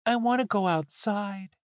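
put-on high little-boy voice; young boy. A narrator's reading. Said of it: "shrinking back into boyhood".

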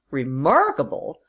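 incredulous echo of the word; indignant cry. A narrator's reading. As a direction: throw it back in indignant disbelief. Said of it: "cried the other".

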